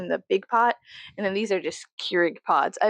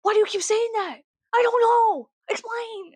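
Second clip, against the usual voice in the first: mom mad; 12 semitones higher than usual. Retold or imitated. Mom scolding her son for saying you know, mock angry and high. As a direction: imitate an exasperated mom snapping at her son. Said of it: "my mom would get so mad".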